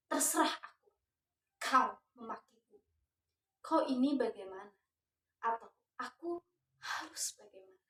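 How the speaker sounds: rising, forceful dramatic declamation; poetry recitation. Acted. Teacher reciting the poem to her students, dramatic declamation. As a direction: recite it like a stage protest poem.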